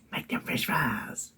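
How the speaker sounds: hushed, drawn-out urging of a tempting inner voice; inner tempter. Acted. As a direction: coax it in a slow, breathy half-whisper. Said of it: "You know them two girls I have. And she was like".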